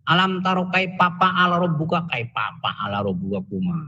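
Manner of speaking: ordinary delivery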